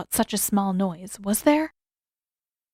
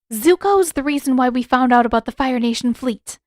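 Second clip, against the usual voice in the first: urgent; 4 semitones higher, 6 dB louder than usual. A narrator's reading. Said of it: "Katara said urgently".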